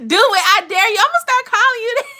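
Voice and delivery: high, loud teasing through laughter; playful dare, laughing, fast; laughing tease. Real, not acted. Teasing a viewer.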